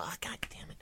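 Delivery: whispered curse of defeat; muttered curse. Retold or imitated. Mother reacting to daughter's admission, whispered frustration. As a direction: curse under your breath.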